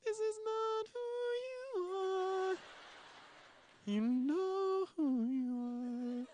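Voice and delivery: very high, soft and very slow, hushed and awed; high hushed film quote, drawn out; quoting the movie line. Retold or imitated. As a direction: breathe it out like the movie's tender moment. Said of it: "and she says".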